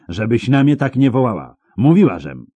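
high, loud scolding; panted rebuke. A narrator's reading. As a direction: scold sharply after a fight, pitched high. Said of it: "wydyszała Milwa".

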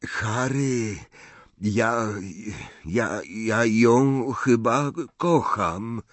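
halting, slow, strangled confession; choked voice. A narrator's reading. Boy confessing love to his friend, choked voice. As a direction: stumble through it with a tight throat. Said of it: "wyznał Ron zduszonym głosem".

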